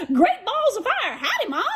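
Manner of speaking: high, laughing twangy greeting; baby goose voice. Acted. Baby goose to Jack, high laughing greeting. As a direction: chuckle it out as a new gosling.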